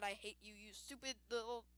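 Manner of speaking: ordinary talk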